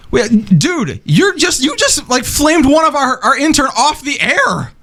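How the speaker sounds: high-pitched disbelief. Acted. Staged on-air argument.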